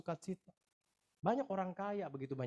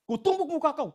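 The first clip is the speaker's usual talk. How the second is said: shouted high and loud, imitating an angry stranger; angry man threatening the pastor, loud mock shout; mock furious shout; 12 semitones higher, 9 dB louder than usual. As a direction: bellow the threat like the man at the shop. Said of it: "Dia balik bilang".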